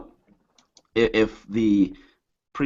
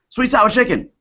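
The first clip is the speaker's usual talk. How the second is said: high mock voice of the restaurant worker; a mocking high-pitched worker voice; 11 semitones higher than usual. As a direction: squeak out the restaurant's answer.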